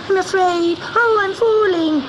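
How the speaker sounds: crying kitten. A narrator's reading. Kitten crying out in fear as he falls. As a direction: cry out as he slips. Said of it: "sobbed Gobelino".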